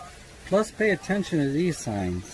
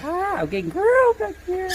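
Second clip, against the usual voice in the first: high laughing yelp. Real, not acted. Birds biting, laughing.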